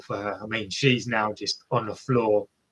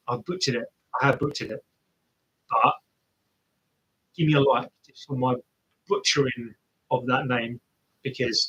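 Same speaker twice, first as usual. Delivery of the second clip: normal talking voice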